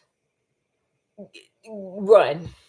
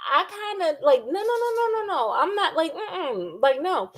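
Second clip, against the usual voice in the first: flustered no; 5 semitones higher than usual. Retold or imitated. Voicing Ingrid's flustered refusal.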